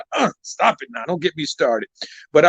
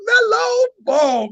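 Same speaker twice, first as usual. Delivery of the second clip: hyped announcement; 13 semitones higher, slower than usual. Real, not acted. Host announces show name.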